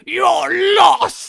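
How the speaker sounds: booming roar; Brian Blessed roar. Retold or imitated. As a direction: roar it like a mighty king. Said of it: "Brian Blessed is like".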